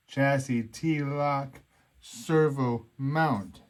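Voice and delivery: reading the part name slowly, word by word; slow spelled-out reading. Shown as a demonstration. Vlogger sounding out a clunky part name, slow. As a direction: drag out the odd part name. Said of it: "Makes total sense, right?".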